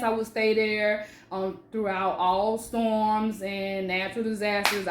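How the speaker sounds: normal talking voice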